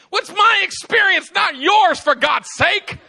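hammed-up furious shout; indignant shout. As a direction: yell back in mock outrage. Acted quarrel, shouting back.

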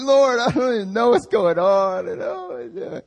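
very high, whiny wailing to God; hammed-up tearful whine; mock crying. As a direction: imitate a pathetic, squealing sob. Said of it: "That's how I was crying".

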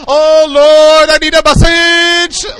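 high, loud hammed-up plea to the Lord; mock desperate cry. Preacher mocking his own panic, a loud hammed-up plea.

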